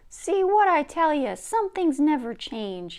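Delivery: loud, high call, slowing at the end; boy yelling after her. Acted. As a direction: shout it down the hallway. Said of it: "he yells out into the hallway".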